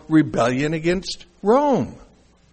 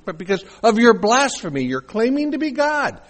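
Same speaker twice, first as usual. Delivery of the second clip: heated accusation; 5 semitones higher, 5 dB louder than usual. Acted. Accusers' retort to Jesus acted out.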